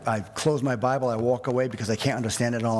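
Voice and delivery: normal talking voice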